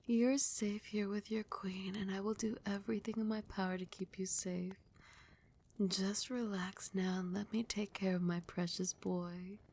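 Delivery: ordinary delivery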